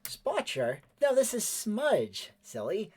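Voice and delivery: put-on high, slow child voice; Cleven voice. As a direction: voice a gentle little kid. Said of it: "he says".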